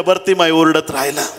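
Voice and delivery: ordinary talk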